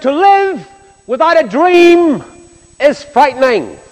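high and loud, the quote proclaimed to the hall; ringing declaration. Retold or imitated. Preacher quoting the rock singer, loud and high. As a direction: lift it up and ring it out like a headline.

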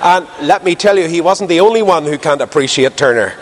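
usual speaking voice

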